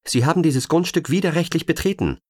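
high, loud agent's warning called up the stairs. Acted. Agent warns the intruder, calling out high and loud. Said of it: "rief sie".

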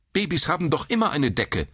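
high, prim woman's voice; stern nurse. A narrator's reading. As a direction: retort primly, pitched high. Nurse retorts to old man. (spröde Krankenschwester)

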